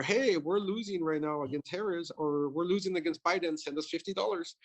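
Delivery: high-pitched imitation of an urgent appeal; mock fundraising plea. Republican Party texting that it is losing, mock plea.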